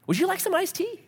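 a kind man offering a drink; polite stranger. Retold or imitated. Guy offers wife iced tea.

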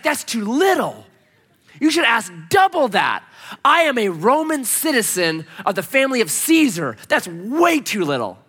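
high, indignant mock outrage; hammed-up wounded pride; incensed. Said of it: "he was incensed".

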